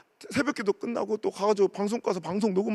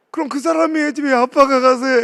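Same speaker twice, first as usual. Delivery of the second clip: put-on high, loud indignant little girl; child's question; 7 semitones higher, 11 dB louder than usual. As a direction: demand an answer like a child. Daughter arguing with dad. (따지듯 묻는 어린 딸)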